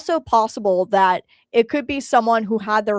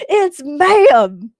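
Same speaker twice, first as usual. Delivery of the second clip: mock correction; 6 semitones higher, 9 dB louder than usual. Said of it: "Yes, ma'am" child".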